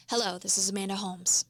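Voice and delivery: calm authoritative "Hello, this is Amanda Holmes"; speaking down. Shown as a demonstration. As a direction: practise "Hello, this is Amanda Holmes" low and firm. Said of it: "I would start it speaking down".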